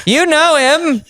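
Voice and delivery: slow, high, grand announcer intro; hype announcer voice. Retold or imitated. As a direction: build him up like a game show host. Continues mock hype intro.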